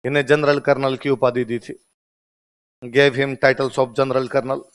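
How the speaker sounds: very slow, each word spaced out for writing; slow classroom dictation, Hindi then English. Shown as a demonstration. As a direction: dictate it so the class can copy every word.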